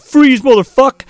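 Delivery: hammed-up police freeze; mock cop shout. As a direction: yell it like a cop at gunpoint. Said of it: "they're like".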